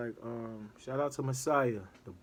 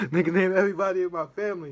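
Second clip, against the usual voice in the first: louder, higher, fast amazed repeat; excited; 6 semitones higher, 9 dB louder, much faster than usual. Real, not acted. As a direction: shout it again in real disbelief. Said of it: "Real talk".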